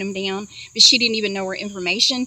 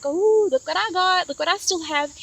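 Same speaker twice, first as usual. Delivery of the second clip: taunting; 6 semitones higher than usual. Retold or imitated. Thief showing off stolen keys, taunting.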